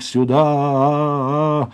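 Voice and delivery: high, long-held call; piercing cry. Acted. Pinya cries out to guards while struggling, piercing. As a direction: stretch the cry out, understated in volume. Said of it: "вырывался пронзительный крик".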